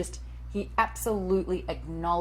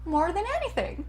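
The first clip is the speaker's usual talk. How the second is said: higher American mom voice, doting and pedantic; American mother voice; 11 semitones higher than usual. Acted. Actress performing Janet the American mother, higher pitched. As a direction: play the fussy American mom who adores her kids. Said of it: "she is an American mother".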